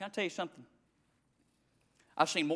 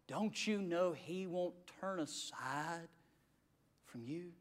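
tender plea; 10 semitones lower, 18 dB quieter, slower than usual. Acted.

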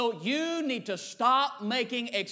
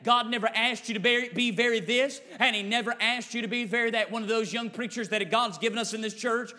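normal talking voice

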